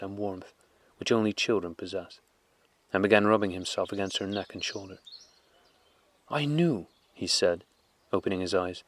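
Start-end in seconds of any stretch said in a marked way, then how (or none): 6.3-6.9 put-on higher little-boy voice; child's voice. A narrator's reading. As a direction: sound like a small boy waking.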